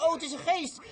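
high, startled "het is een geest"; frightened cry. Acted. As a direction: cry out like scared fishermen in a storm. Terrified disciples cry out.

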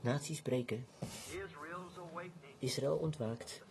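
hushed, low and solemn, long pauses; reverent closing. Real, not acted. Preacher asking the congregation to stand quietly, reverent recital. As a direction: lead them softly into silent reflection.

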